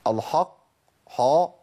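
الحق then حا with thick backed vowels; over-heavy ح in الحق، حا; faulty heavy ح. Shown as a demonstration. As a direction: darken the ح in الحق towards "ho". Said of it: "لكن ما أقولش".

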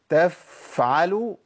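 تفعلوا with a long drawn-out fa; overstretched f. Shown as a demonstration. Teacher mocks the overlong f. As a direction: draw the fa out too long. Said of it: "ده كتير".

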